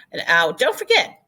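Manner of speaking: ordinary talk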